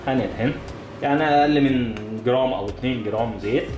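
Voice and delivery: ordinary talk, nothing marked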